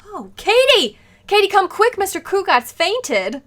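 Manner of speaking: high, loud cry for help; alarmed call. Acted. Wife calling the maid because husband fainted, alarmed shout. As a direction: shout urgently to someone in another room.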